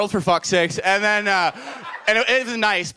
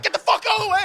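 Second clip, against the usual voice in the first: angry manager; 13 semitones higher, much faster than usual. Retold or imitated. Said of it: "He's like".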